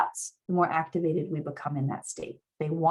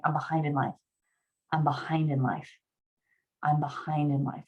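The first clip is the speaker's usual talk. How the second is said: lower, matter-of-fact statement of "I'm behind in life"; stated as fact; 4 semitones lower, 4 dB quieter than usual. Shown as a demonstration. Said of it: "as a statement".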